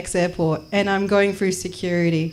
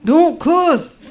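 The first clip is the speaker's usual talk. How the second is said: high scolding order, repeated; security guard; 6 semitones higher, 3 dB louder, much slower than usual. Acted. Guard reprimanding traveller, high scolding voice. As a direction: scold higher the second time. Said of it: "He's like".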